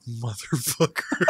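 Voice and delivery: slow, soft mock curse; retold pissed-off dad. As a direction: draw it out under your breath as Dad. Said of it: "he was pissed".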